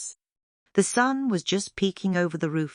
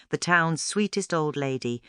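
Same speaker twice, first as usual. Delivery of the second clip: plain reading voice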